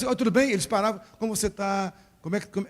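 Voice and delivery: fast, tumbling words; rushed greeting. Shown as a demonstration. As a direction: rattle off the greeting quickly. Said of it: "ah, tô falando rápido".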